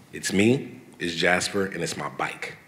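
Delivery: normal talking voice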